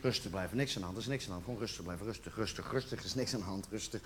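low, hushed monotone self-talk; self-soothing. Acted. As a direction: mutter it low to keep yourself calm. Talks himself calm, barely holding in. (zachtjes jezelf sussen, laag en monotoon)

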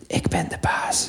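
breathy whisper into the ear; slow whisper, barely a laugh. Retold or imitated. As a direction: whisper it to the dog, slowly. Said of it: "ik fluister in zijn oortjes".

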